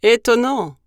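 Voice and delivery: big beast taken aback; surprised gruffalo. Acted. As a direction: boom out your amazement. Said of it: "répondit le gruffalo surpris".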